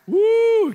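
high, loud joyful whoop; excited whoop. Real, not acted. Pastor cheering after the verse, a loud high whoop. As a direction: let out a happy cheer.

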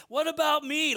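high, loud, pleading cry; John in prison, crying out to Jesus; anguished cry. Retold or imitated. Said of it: "he's like".

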